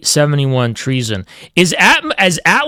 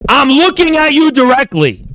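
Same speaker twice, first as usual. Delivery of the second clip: much higher, louder, put-on mock-threatening voice; mock menacing proclamation; 10 semitones higher, 4 dB louder than usual. Streamer taunting a viewer, high hammed-up threat. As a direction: ham up a solemn warning.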